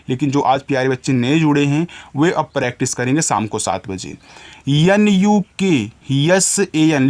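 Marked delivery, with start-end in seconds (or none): 4.7-7.1 slow, letter by letter; spelling letters out. Shown as a demonstration. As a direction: spell each letter slowly for the children. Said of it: "teacher spells letters for children".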